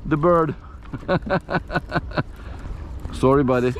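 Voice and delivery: ordinary talk, nothing marked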